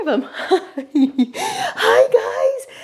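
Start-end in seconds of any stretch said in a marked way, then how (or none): 1.4-2.8 very high cooing hi. Real, not acted. Cooing to her baby.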